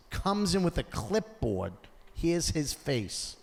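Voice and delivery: usual speaking voice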